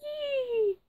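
very high, thin bird shriek; bird's shriek. A narrator's reading. Green bird shrieking out from a tree. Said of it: "with an ear-splitting shriek".

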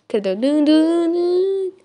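a rising imitated horn blast, ntoun ntoun; car horn imitation. Vlogger copying the wedding horns outside, an imitated honk. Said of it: "ένα αμάξι κόρναρε".

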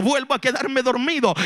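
usual speaking voice

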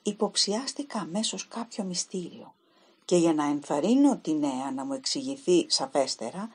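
plain reading voice

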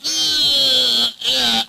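high, loud straining roar; roaring heave. A narrator's reading. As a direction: roar as you give a great heave. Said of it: "he roared".

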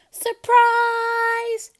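very high, loud cheer; shouted surprise. Acted. Party guests shouting at Seymour, high and loud. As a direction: burst out with joy. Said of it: "everybody yelled".

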